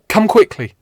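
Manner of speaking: loud, high, urgent shout; urgent call. Acted. Page calling Richard to the wounded captain, urgent loud shout. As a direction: yell it so he drops the nets. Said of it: "I call out".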